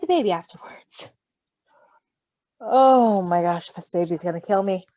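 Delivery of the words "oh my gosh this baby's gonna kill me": slow, drawn-out gush; overwhelmed by cuteness. Acted. Marshall gushes over the baby, slow and overwhelmed. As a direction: let the cuteness floor you.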